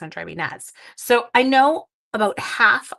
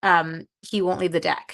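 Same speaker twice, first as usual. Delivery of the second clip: ordinary talk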